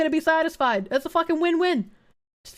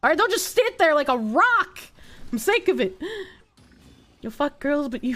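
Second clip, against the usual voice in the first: ordinary talk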